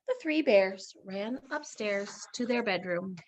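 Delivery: ordinary delivery, nothing marked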